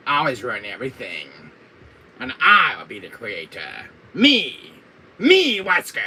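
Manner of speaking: villain rant building to shouts; hammed-up megalomaniac outburst; Wesker. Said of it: "getting into my Wesker fever".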